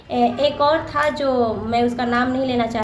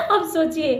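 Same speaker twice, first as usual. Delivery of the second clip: ordinary talk, nothing marked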